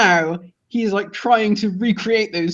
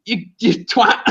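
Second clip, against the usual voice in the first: high-pitched incredulous telling-off; exasperated scolding; 6 semitones higher than usual. Acted. Fan telling off a doomed general, exasperated. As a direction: squeal the warning in mock exasperation.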